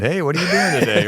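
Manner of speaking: high, quick teasing mimicry; mocking imitation. Co-host mimicking his friend's sandwich guy, in a high mocking voice. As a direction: exaggerate the sandwich guy's voice to tease. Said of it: "You make fun of my voice".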